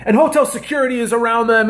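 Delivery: ordinary talk, nothing marked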